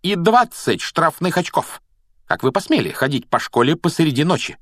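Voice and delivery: high-pitched stern scolding; indignant cry. A narrator's reading. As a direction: scold high and outraged, like a strict professor. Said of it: "вскричала она". (возмущённо, высоким строгим голосом)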